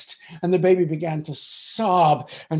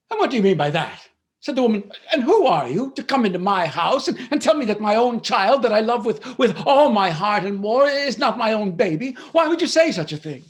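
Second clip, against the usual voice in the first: louder, higher, indignant protest; indignant mother; 5 semitones higher, 5 dB louder than usual. Acted. Mother protests to the stranger, loud and indignant. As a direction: bristle and demand how she dares. Mother defends her baby.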